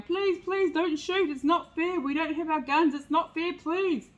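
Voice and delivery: hammed-up begging for mercy; begging hunters.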